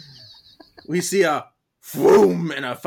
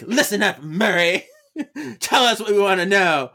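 tags=1; 5 semitones higher, 4 dB louder than usual. Retold or imitated. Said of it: "she's like".

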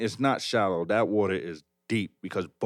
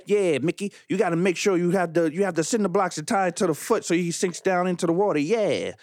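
put-on a light fifties Italian mobster accent; Italian mobster accent, lightly done; fifties gangster accent; 7 semitones higher than usual. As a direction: play the Italian mobster accent casually, not broad. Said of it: "Why are you talking in this accent".

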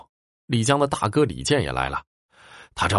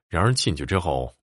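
usual speaking voice

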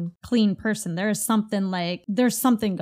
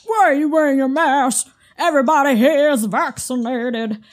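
louder, higher, snapping accusation at a masked person; mock angry customer; 4 semitones higher, 6 dB louder than usual. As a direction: imitate an aggressive stranger confronting you. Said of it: "And they're just like".

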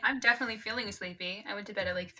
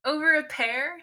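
high, outraged disbelief; mock shocked; 6 semitones higher, much slower than usual. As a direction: protest in playful disbelief. Reacting to the rescinded proposal.

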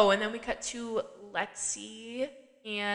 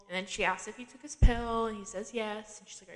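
ordinary talk, nothing marked

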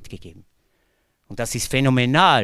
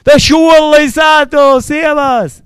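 much higher, louder, slow hearty hello from the regulars; loud pub greeting; 15 dB louder, slower than usual. Retold or imitated. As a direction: bellow it like the locals at the bar. Villagers greet him at the pub.

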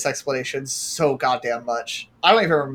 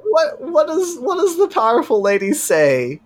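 mock grand question; 11 semitones higher than usual.

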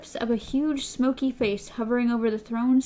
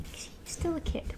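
whisper; 6 semitones higher, 11 dB quieter than usual. Retold or imitated. Podcast host mimics whisper.

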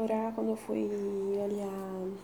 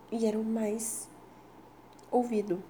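normal talking voice

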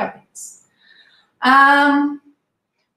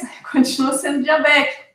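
usual speaking voice

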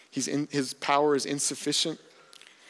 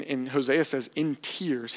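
ordinary talk, nothing marked

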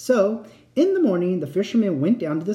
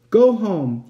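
loud, raised angry command; thundering; 5 semitones higher, 7 dB louder than usual. A narrator's reading. Flounder dismissing the fisherman, thundering. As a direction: send him away with force. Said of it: "the flounder thundered back".

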